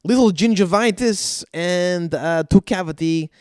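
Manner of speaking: lightly put-on broken-English dentist voice; mock the dentist down the hall with a foreign accent. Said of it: "he goes".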